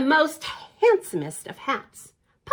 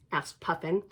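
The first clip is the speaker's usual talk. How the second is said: usual speaking voice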